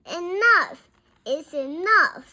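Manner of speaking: loud, high, emphatic protest; fed-up outburst. A narrator's reading. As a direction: put your foot down loudly. Frank orders the noise to stop.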